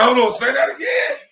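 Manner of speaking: high, loud squeal of discovery; acted high-pitched outburst; excited interruption. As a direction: pitch it way up, demanding a repeat. Acts out his excited reaction.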